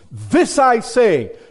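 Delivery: "This I say" high, loud and slow; strong declaration. Acted. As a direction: proclaim "This I say" to a listener, every word punched. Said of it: "that's coming across pretty strong".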